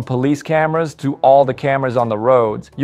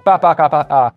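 laughing babble. Real, not acted. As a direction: laugh it out in silly syllables. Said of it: "I couldn't stop laughing".

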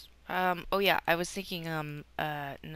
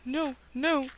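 louder, higher panicked cries; 7 semitones higher, 5 dB louder than usual. Real, not acted. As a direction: panic as mobs close in. Mobs attack in game.

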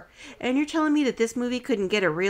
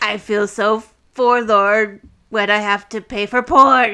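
louder mock verse recital; rhyming goblin; 9 dB louder than usual. Host finishes the goblin's joke rhyme, mock recital. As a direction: belt out the daft rhyme's punchline. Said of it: "And they're rhyming".

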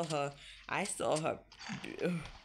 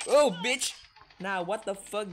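alarmed yelp; 6 semitones higher, 9 dB louder than usual. Real, not acted. Alarmed by enemy.

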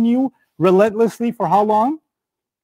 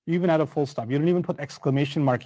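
normal talking voice

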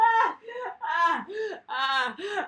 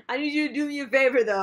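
ordinary talk

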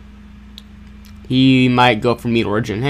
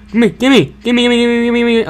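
eager; 8 semitones higher, 4 dB louder than usual. Real, not acted. Chasing food in the game.

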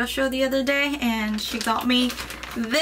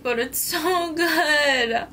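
delighted; 5 semitones higher, much slower than usual. Real, not acted. Tasting food, delighted.

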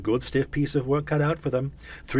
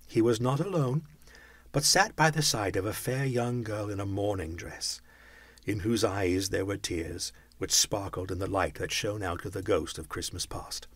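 ordinary delivery, nothing marked

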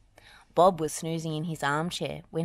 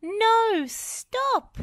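very high, alarmed yell; urgent high-pitched shout; 5 dB louder than usual. A narrator's reading. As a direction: shout a warning to stop. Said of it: "yelled Wendy".

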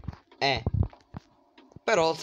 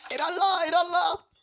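very high, loud panicked cry; frightened shriek; 6 dB louder than usual. Real, not acted. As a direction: shriek in sudden terror. Spavento, vede il nemico. (urlo di spavento)

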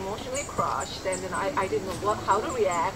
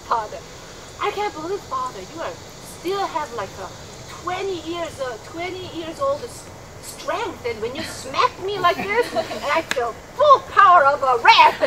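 higher-pitched, imitated cheerful awe; smiling admiration; 6 semitones higher, 4 dB louder than usual. Husband praising father's smack, smiling good attitude. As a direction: imitate a husband marvelling at his father's strength. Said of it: "such a good attitude, with a smile".

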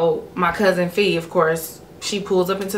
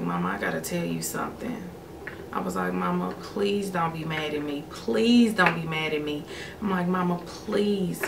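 soft pleading; 8 dB quieter than usual. Retold or imitated. Begging mom not be mad.

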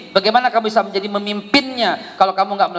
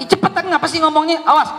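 higher, fast, impatient nagging; impatient parent; 8 semitones higher, faster than usual. Acted. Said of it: "impatient parent". (ibu tidak sabar, cepat dan tinggi)

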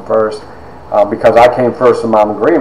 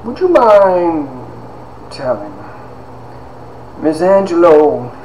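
eccentric professor, drawn out with long pauses; Doctor Stoneburner; 3 semitones higher, 6 dB quieter, slower than usual. Retold or imitated. Teacher asking student to tell his story, eccentric impression. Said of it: "he was all eccentric".

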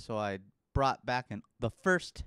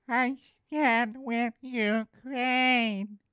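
very slow, much higher, sarcastic sweetness; mock earnest post; 10 semitones higher, much slower than usual. Typing a solidarity post, sappy and slow. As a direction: drag it out like a sappy slogan. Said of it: "take our thumbs and type in".

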